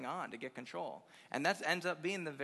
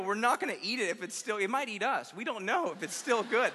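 loud, high comic outrage; incredulous rant; 6 semitones higher, 7 dB louder than usual. Retold or imitated. Grandchild objecting to eating the burnt dinner, incredulous mock rant. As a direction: build a hammed-up tirade of disbelief.

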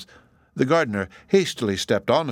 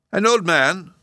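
high, louder startled exclamation; an old man thrilled by the news; high and excited; excited cry; 9 semitones higher, 4 dB louder, slower than usual. A narrator's reading. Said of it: "cried the professor".

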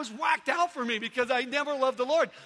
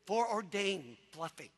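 low, quiet, slow mock puzzlement; puzzled listener; 7 semitones lower, 9 dB quieter, slower than usual. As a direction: play a baffled listener muttering to themselves. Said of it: "you might be out there going".

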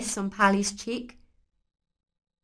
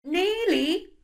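squeaky, higher quick answer; guilty high reply; 10 semitones higher than usual. A narrator's reading. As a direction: sound caught out. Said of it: "replied Pally".

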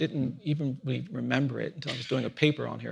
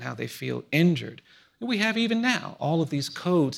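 normal talking voice

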